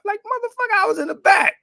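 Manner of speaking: very high, loud indignant cry; a son indignant at being called in for nothing; exasperated outburst. Acted. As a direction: protest like you just walked across the whole house.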